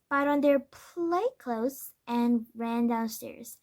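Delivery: ordinary delivery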